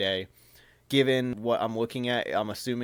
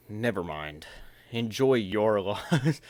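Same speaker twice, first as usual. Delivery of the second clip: smug dismissal; much slower than usual. Retold or imitated. Vlogger voicing the millionaire brushing her off, smug and slow. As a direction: toss it off like a smug rich guy.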